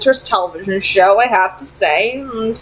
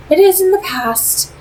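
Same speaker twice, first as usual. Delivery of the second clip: put-on higher, poised vampire lady with a faint accent; Camille; 11 semitones higher than usual. A narrator's reading. Vampire leader tempting Simon with an offer, poised and higher. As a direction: speak as a cool, persuasive vampire leader.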